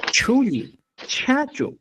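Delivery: "Chuli" and "chachu" said high with breath; aspirated word demo. Shown as a demonstration. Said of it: "hablamos de esa manera".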